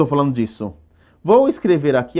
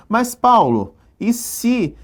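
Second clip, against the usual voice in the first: lightly voiced student, pitched higher; imagined student's question; 9 semitones higher, slower than usual. Teacher voicing a student's doubt about the rule, lightly acted questioning student. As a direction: raise the pitch as if interrupting the teacher.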